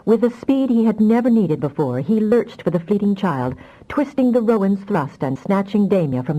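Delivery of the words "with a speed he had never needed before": ordinary delivery, nothing marked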